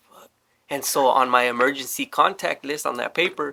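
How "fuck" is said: whispered "What the fuck?", no voice at all. Retold or imitated. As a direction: hiss "What the fuck?" under your breath. Quoting his own stunned reaction.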